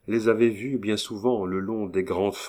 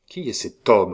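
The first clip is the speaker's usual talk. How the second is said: insistent demand; 2 semitones higher, 6 dB louder than usual. A narrator's reading. Repeated demand.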